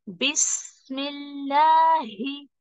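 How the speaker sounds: बिस्मिल्लाही very slow, with a long flowing ही; correct drawn-out reading. Shown as a demonstration. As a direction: stretch बिस्मिल्लाही. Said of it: "बिस्मिल्लाह नहीं बोलने का". (बिस्मिल्लाही को खींचकर)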